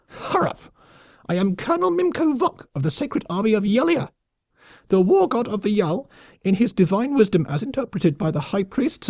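very high, squeaky military proclamation; pompous lemming officer. A narrator's reading. Colonel proclaiming his war god's decree, pompous. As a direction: declaim self-importantly in a squeaky high voice. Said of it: "insufferably pompous as well".

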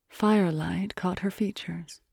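ordinary delivery

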